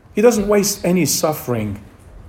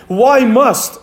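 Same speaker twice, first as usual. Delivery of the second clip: "must" said with firm, raised emphasis; stress on must; 4 semitones higher than usual. Real, not acted. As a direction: drive home the word "must". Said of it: "the emphasis is on the word must".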